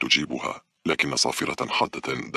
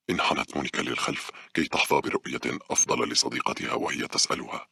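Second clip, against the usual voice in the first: plain reading voice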